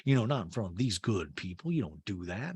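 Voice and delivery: low, soft put-on voice; Sutton. Housewife at the dinner table, low hushed imitation. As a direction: mimic a housewife speaking low and discreetly. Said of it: "Sutton to Garcelle goes".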